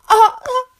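high, loud climax cry; ecstatic cry. Acted. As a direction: cry out at the peak. Peak cry.

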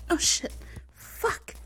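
sudden startled outburst; startled gasp. Acted. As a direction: jump at the fright. Startled by someone.

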